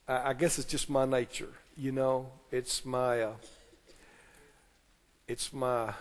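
low, quiet, halting excuse-making; sheepish excuse. Acted. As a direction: fumble softly for an excuse, trailing into pauses. Acting out a congregant's excuse.

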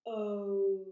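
O held long and loud; long vowel O. Shown as a demonstration. Teacher shows the circle shape, O stretched loudly. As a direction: push out a long O, lips closing to a small circle. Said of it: "start at the front and we move back".

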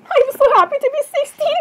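very high, squealing princess glee; gushing excitement. Acted. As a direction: squeal it with over-the-top delight. Princess gushing about being sixteen.

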